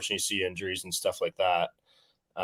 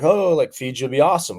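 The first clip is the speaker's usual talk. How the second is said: higher, louder imitation of a gushing mom; excited mom; 7 semitones higher, 6 dB louder than usual. Mom cheering on her child's trip to Fiji, excited. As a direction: play an excited mom, pitch jumping up on "Oh". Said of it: "my mom will be like".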